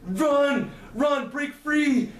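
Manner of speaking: high-pitched desperate cry, held back in volume; desperate scream. A narrator's reading. Reiko screams as blades fly at him, desperate high cry. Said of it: "Reiko screams".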